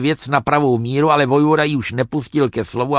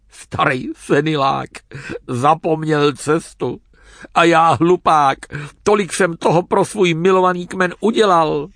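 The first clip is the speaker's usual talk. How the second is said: tearful self-pity; 4 semitones higher than usual. A narrator's reading. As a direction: choke up, then rise into hurt indignation. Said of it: "Čechovi hrkli do očí slzy". (plačtivá, ukřivděná lítost)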